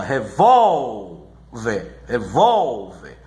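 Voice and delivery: "Revólver" with VÓL stretched out long, then a low VER; stressed middle syllable. Shown as a demonstration. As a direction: slowly lean hard on VÓL in "Revólver". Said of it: "O acento é sempre na sílaba mais forte".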